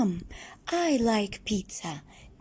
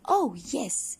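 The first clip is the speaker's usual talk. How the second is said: usual speaking voice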